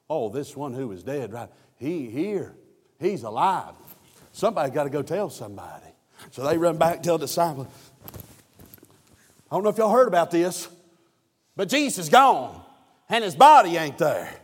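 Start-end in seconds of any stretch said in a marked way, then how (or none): 3.0-14.3 loud, high, rising whoop; preaching at full force. Acted. Preacher telling the church Jesus is risen, loud and high. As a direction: drive the news home at the top of the voice.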